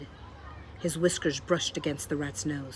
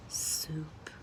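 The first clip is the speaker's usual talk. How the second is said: a soft breathy moan, much softer; moaned softly; 9 dB quieter than usual. A narrator's reading. Roscuro moaning for soup before he cries. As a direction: sigh it out with longing. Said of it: "moaned Roscuro".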